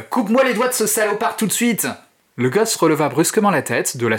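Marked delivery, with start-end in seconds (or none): 0.1-1.9 high, urgent shouted command; shouted order. Acted. Man yelling at the kid to cut fingers, urgent shout. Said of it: "hurla-t-il".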